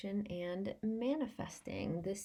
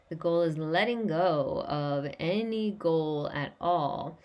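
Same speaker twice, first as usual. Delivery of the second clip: ordinary talk, nothing marked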